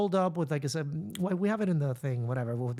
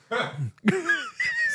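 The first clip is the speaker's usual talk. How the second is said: high burst of laughter; big laugh. Real, not acted. Laughing at her mom's dodge. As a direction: let out a high exasperated laugh.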